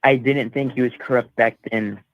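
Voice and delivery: normal talking voice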